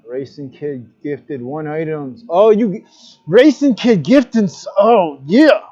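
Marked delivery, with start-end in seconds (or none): 2.3-5.6 excited. Real, not acted. Thrilled by a gift sub.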